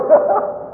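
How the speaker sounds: very high breathy giggle; a last giggle at the joke; real laugh. Real, not acted. Laughs at his own joke. (tiếng cười khúc khích thật, rất cao)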